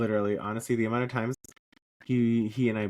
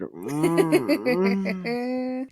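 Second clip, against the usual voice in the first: squeaky hum; 13 semitones higher, 3 dB louder than usual. Acted. Comic tired hum.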